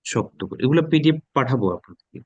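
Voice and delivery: usual speaking voice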